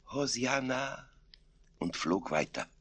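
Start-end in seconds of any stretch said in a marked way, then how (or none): 0.1-1.1 soft, high, ethereal hosanna; lisping murmur. A narrator's reading. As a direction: breathe it gently like a rapt saint. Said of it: "Dieser lispelte nur". (leise gelispelt)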